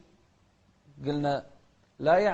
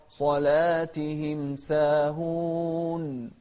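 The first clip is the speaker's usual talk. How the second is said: slow, sung recitation; slow sung Quran recitation; melodic Quran recitation; 4 dB louder, much slower than usual. Shown as a demonstration. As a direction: chant it slowly and melodically.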